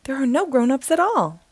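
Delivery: usual speaking voice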